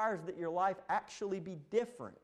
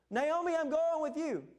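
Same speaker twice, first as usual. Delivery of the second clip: much higher, louder eager pledge; eager follower; 11 semitones higher, 5 dB louder than usual. Retold or imitated. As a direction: promise to follow, high and loud. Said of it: "eager follower voiced".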